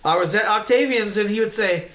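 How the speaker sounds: ordinary talk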